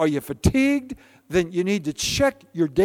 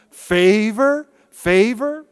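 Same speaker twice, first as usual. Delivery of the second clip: favor called out rising and louder; 4 semitones higher, 5 dB louder, much slower than usual. Real, not acted. Preacher calling out blessings to the church. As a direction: lift each favor higher. Preacher repeating key word.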